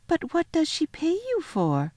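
put-on high little girl's question; Lucy, puzzled. A narrator's reading. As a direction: ask lightly in a girlish voice. Girl questions faun.

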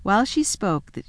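usual speaking voice

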